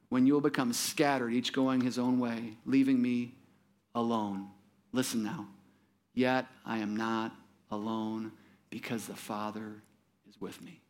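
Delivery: low, hushed reading of Jesus' words; solemn scripture reading. Acted.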